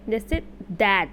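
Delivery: that said slowly with a short flat a; short vowel demo. Shown as a demonstration. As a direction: draw out that to show its vowel. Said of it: "अ का sound आ रहा है".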